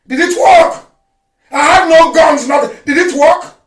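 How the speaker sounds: did it work, shouted mockingly; taunting shout. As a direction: throw the question back loud.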